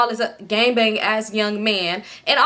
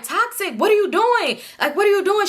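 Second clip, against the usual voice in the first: high-pitched, disbelieving mock confrontation; incredulous challenge; 9 semitones higher than usual. Storyteller replaying how she confronted Toxic, high-pitched and incredulous. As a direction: act out calling a friend to account, pitched up. Acted challenge to her friend.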